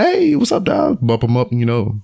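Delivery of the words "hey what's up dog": very high, drawn-out acted hello; friendly greeting. Greeting the guy on the other couch, high and friendly. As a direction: call out a big high-pitched hey to a stranger.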